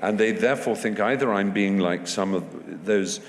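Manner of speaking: ordinary delivery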